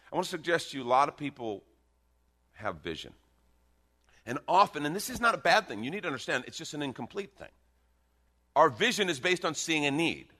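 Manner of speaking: high, loud, driving sermon voice; full-force preaching. Acted. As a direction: preach it with rising fire.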